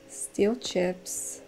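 quiet sneaky murmur; hushed sneaking. Real, not acted. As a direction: keep it low so nobody hears. Sneaking past granny.

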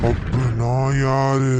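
very low, slow ghostly voice; eerie low ghost asking who she is. Acted. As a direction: speak as a lurking ghost. Ghost speaks as the mother.

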